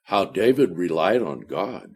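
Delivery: ordinary delivery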